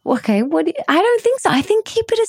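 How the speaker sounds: high squealing refusals; excited. Real, not acted. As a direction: squeal no, giddy and teasing. Refusing to reveal the secret.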